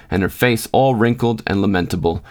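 usual speaking voice

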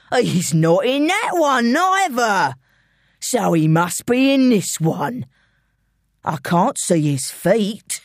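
slow, gloating London gangster; London villain. Acted. Intruder closing in on George's hiding place, gloating. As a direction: taunt the cornered prey, drawn out.

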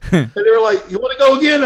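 loud laughing high retelling. Real, not acted. Lifter laughing hard while retelling the officials' offer, loud and high. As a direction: laugh through the quote, loud.

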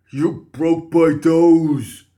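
loud, high, blocked-nose wail; pained cry. A narrator's reading. Guard with broken nose accusing Archie, crying out. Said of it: "the guard cried".

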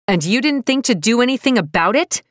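loud, high furious shout. Acted. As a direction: yell it at her. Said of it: "I'm shouting now".

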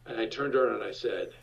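normal talking voice

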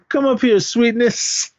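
mock sweet-talk. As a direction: call him up like a mocking flirt.

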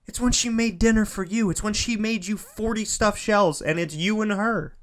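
ordinary talk, nothing marked

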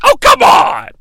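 high exasperated shout; "come on" yelled high in mock outrage; fed-up crowd. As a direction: protest like a disgusted crowd. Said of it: "the crowd is finally like".

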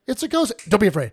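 high cry cut straight off by a loud answer. Shown as a demonstration. Showing how fast Jesus answered the disciples, quick back-to-back cries. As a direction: race from panicked yelp into instant reassurance. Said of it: "Can I get quicker than that?".

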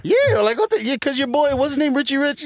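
high, animated recall; excited. Real, not acted. Teasing a friend about his buddy, high excited voice. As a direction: pitch it up, eager to name him.

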